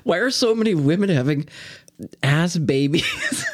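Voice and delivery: laughing. Real, not acted. Laughing mid-question.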